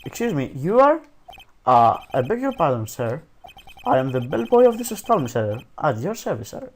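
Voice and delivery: a clumsy British bellboy voice; bad British accent. Bellboy greeting the lawyer in a bad British accent. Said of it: "I do the worst British accent in the world".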